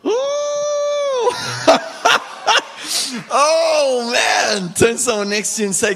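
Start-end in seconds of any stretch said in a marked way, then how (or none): 0.1-4.7 high, loud laugh then shout. Real, not acted. Preacher erupting after the Aslan line.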